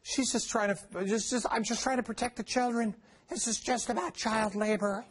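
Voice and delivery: high, put-on pleading do-gooder voice; mocking the labor secretary. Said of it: "Now, her response is".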